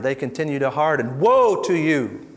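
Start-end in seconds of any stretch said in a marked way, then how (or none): 1.2-2.3 slow, loud prophet's woe; thunderous wrathful prophet. Acted. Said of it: "Now he's the prophet".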